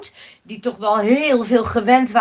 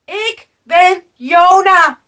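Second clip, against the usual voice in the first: slow, loud, raised declaration; calling out; 5 semitones higher, 9 dB louder, slower than usual. A narrator's reading. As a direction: shout your name deliberately. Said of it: "riep hij". (langzaam en luid uitroepen)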